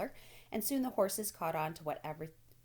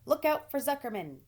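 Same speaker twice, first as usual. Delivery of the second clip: loud, high warning yell; gander yelling; 7 semitones higher, 7 dB louder than usual. A narrator's reading. Gander yelling a warning to Wilbur, loud. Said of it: "yelled the gander".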